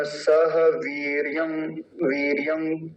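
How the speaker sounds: वीर्यं read slowly ending in nasal; slow demo. Shown as a demonstration. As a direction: draw out सह वीर्यं. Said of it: "इसका उच्चारण क्या होता है". (धीरे-धीरे वीर्यं)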